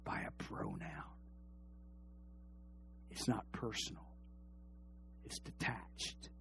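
whispering that it is distant. Acted. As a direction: breathe the words out. Hushed closing lines.

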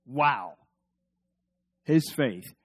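ordinary delivery

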